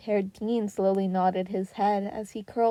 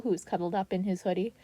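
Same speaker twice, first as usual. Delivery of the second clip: ordinary delivery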